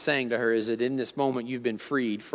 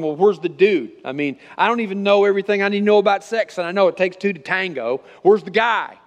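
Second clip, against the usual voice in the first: loud, high-pitched mock protest; raised-voice retelling of a child's objection; retold childhood protest; 6 semitones higher, 7 dB louder than usual. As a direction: re-enact a puzzled kid demanding an answer. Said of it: "I remember even being nine and ten year olds going".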